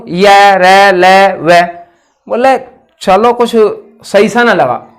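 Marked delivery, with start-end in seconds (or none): 0.1-1.6 य, र, ल, व said one by one, slowly and clearly; slow letter demo. Shown as a demonstration. Teacher sounding out the semivowels, clear demo. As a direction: sound out each letter apart for the class. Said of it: "अंतस्थ में बोल के देखो".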